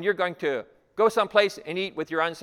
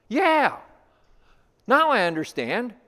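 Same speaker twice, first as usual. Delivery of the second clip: high-pitched, louder burst of understanding; sudden realization; 7 semitones higher, 3 dB louder than usual. Acted. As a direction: act out the light coming on. Acts out listener suddenly understanding.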